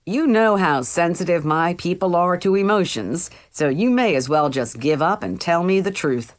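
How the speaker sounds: ordinary delivery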